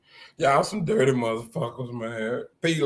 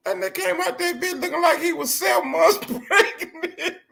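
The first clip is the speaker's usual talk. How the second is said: high, laughing shout; breaking into laughter mid-line; laughing hard; 14 semitones higher than usual. Real, not acted. Cracking up at his boy on the live video.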